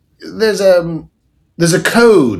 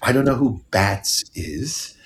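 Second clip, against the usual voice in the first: whisper; 6 dB quieter than usual. Retold or imitated. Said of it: "And she'd be like".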